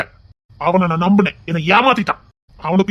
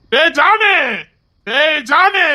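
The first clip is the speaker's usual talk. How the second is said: put-on high-pitched drunken holler; shouting; 6 semitones higher, much slower than usual. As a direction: call out with a long rising yell. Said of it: "ஐயோ, கத்தாதீங்க மாமா".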